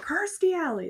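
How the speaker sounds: sudden high, loud blurt of "Kirstie Alley"; blurted the wrong name with full conviction; eager wrong guess. Retold or imitated. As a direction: shout "Kirstie Alley" as if sure you've got it. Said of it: "I was like".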